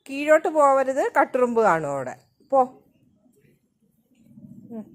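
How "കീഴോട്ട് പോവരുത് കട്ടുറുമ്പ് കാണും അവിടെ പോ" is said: calling out. Real, not acted. Mother calling to child outside.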